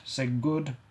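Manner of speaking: ordinary delivery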